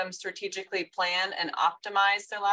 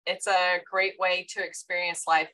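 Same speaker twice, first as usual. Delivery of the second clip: ordinary delivery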